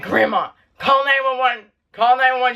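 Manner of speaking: mildly urgent high mock yell; retold scream. Injured grandchild begging grandma to call, retold scream. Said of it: "started screaming for Grandma".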